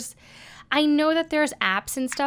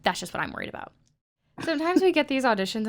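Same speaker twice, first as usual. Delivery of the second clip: ordinary delivery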